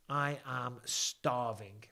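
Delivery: each word drawn out slowly; slow emphatic statement. Real, not acted. Streamer telling viewers he is hungry, slow and emphatic. Repeats his hunger for emphasis.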